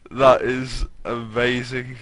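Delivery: lightly voiced colt, slow and drawn out; a hint of a clumsy British colt, slow; Pipsqueak voice. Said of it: "Pipsqueak said".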